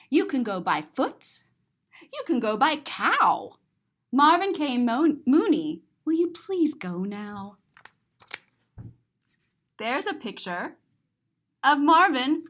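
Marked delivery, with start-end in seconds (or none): none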